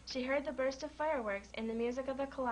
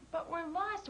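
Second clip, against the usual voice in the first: wailing rabbit; 6 semitones higher, slower than usual. A narrator's reading. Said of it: "wailed the floppy-eared rabbit".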